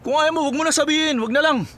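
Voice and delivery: sulky, fast and sharp, pitch up; irritated snap. Acted. Berto snapping at Sylvia over the secret, irritated. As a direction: brush her off in a huff.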